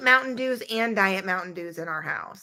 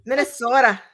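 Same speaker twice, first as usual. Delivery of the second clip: put-on Minnesota accent. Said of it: "without saying Minnesota".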